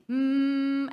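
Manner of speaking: tight closed-lip hum; squeezed hum. Shown as a demonstration. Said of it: "think about squeezing".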